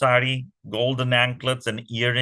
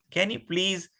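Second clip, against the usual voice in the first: usual speaking voice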